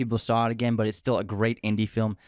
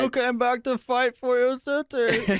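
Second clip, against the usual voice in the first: high, slow, laughing movie line; movie quote voice; 13 semitones higher, slower than usual. Retold or imitated. Quoting Batman Begins line.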